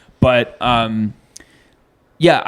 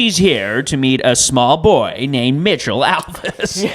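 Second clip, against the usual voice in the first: Chris Hansen impression; 3 semitones higher than usual. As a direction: draw it out like a sting show reveal. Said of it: "when I do Chris Hansen".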